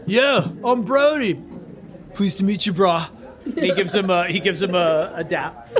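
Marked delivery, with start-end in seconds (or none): 0.1-3.1 laid-back Riptide. Acted. Riptide introduces himself with a dap, laid-back. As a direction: stretch it out like a chilled-out bro. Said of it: "Riptide's like".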